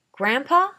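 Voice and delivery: loud high call into the dark. A narrator's reading. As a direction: call up the stairs, worried. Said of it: "I called out".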